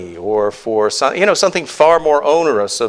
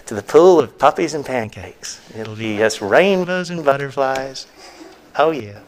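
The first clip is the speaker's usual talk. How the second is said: mock reassurance. Preacher mimics someone who won't warn sinners, falsely reassuring.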